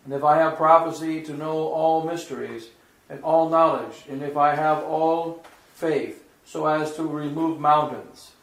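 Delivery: solemn, measured liturgical reading; formal reading with firm emphasis; scripture reading. Acted. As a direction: proclaim the epistle phrase by phrase. Reads the epistle aloud.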